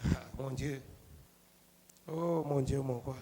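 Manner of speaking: very soft, low sigh to God; almost to himself, in reverence; barely voiced devotional aside; hushed prayer. Real, not acted. Private prayerful sigh mid-sermon.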